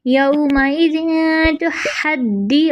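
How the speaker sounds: slow recited यौमाइज़िन तो हद्दी, doubled dal held; Quranic recitation. Shown as a demonstration. Teacher recites the phrase slowly for students. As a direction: chant slowly with a held pause. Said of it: "एक second का pause देकर".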